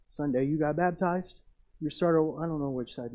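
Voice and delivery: ordinary delivery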